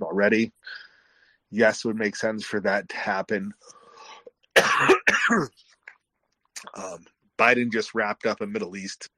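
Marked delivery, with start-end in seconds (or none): 4.5-5.5 harsh chesty cough from a cold. Real, not acted. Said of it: "I just can't stop coughing".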